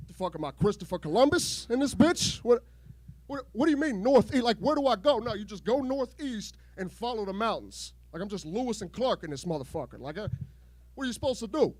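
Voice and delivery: ordinary delivery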